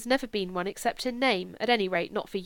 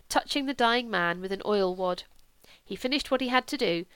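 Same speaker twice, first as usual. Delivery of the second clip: usual speaking voice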